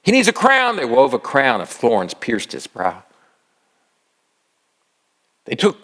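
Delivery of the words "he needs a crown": high-pitched mock jeer; taunting, pitch pushed way up. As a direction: make fun of him in a much higher voice. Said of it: "making fun of him".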